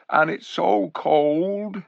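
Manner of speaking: high, slow, plaintive donkey voice; shivering donkey. Acted. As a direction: whimper it like a timid cold donkey.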